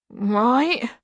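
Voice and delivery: lightly voiced hopeful monster; a touch of eager questioning. A narrator's reading. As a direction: lift the word with rising hope. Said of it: "said the monster, hopefully".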